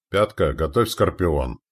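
usual speaking voice

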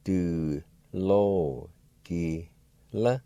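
Du lo ki la drawn out very slowly; careful slow model of Du lo ki la. Shown as a demonstration. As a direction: stretch every syllable of Du lo ki la. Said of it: "Pronounced like this".

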